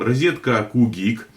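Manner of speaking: usual speaking voice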